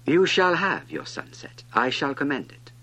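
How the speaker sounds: loud royal promise; commanding king. A narrator's reading. King promising a sunset, loud and commanding. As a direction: grant it with pompous authority.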